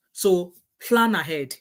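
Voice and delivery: "plan ahead" drawn out slowly, falling on "plan"; slow emphasis. Acted. Urging viewers to prepare, slow deliberate emphasis. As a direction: spell it out slowly so it sinks in. Closing advice, slowed right down.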